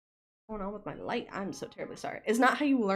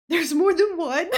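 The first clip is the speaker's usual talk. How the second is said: high, loud, laughing "There's more than one?"; mock ignorant American; 8 semitones higher, 11 dB louder than usual. Mocking herself as an ignorant American, laughing loudly. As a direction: ham up a clueless American gasping in disbelief. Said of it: "little American ignorant person".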